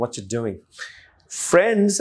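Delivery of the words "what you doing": very informal. Shown as a demonstration. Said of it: "খুব informally".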